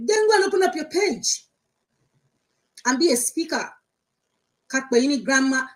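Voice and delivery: high, loud mocking of his pompous titles; mocking imitation. Mocking a man who calls himself Grandpa Blogs, loud and high.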